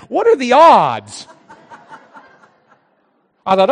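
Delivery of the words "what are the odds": slow and loud, every word weighted; emphatic refrain. Real, not acted. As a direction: land the punchline, drawn out. Refrain line, punchline emphasis.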